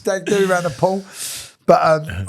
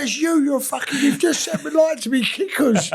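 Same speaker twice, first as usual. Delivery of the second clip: high-pitched mock accusation; put-on indignant squeal; imitating a workmate; 12 semitones higher than usual. Workmate blaming his mate for burnt shoes, imitated high accusation. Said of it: "this is how he talks".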